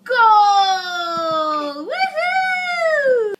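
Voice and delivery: high, loud victory shriek; shrieking jackpot winner. Real, not acted. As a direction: scream like you just won. Celebrates baby reaching puffs.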